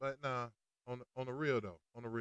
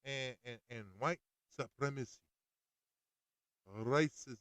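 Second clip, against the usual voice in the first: normal talking voice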